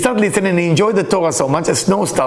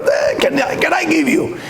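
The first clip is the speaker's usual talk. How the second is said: 1 eager giver; 10 semitones higher, slower than usual. Pretend.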